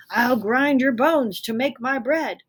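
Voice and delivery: loud, booming giant threat; uppercase giant. Acted. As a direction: roar it in capital letters. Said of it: "stop talking in uppercase letters".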